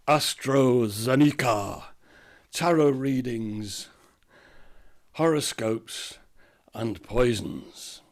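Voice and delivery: slow, deliberate sign reading. A narrator's reading. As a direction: read each item out slowly.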